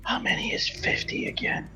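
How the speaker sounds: hushed, voiceless stage whisper; conspiratorial whisper. Acted. Player's character asks a companion about a number, whispering conspiratorially. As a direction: whisper it like a secret between conspirators. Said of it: "whispers conspiratorially".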